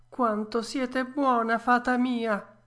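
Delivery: high, slow puppet voice, touched; a grateful wooden boy, drawn out and high; Pinocchio, moved. A narrator's reading. Puppet thanking the fairy, high and tender. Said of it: "disse il burattino asciugandosi gli occhi".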